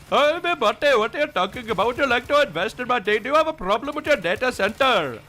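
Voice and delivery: high, loud parody Indian accent; pushy hammed-up Indian-accented caller; mock "Canadian accent". Shown as a demonstration. Streamer pitching investment as joke "Canadian", parody Indian accent. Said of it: "That's the Canadian accent".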